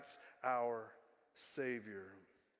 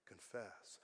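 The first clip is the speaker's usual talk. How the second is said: hushed, low whisper; barely audible whispered call to repent; whispering Spirit; 7 semitones lower, 16 dB quieter than usual. Acted. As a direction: whisper it as a gentle inner prompting. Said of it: "I know the Spirit's also saying".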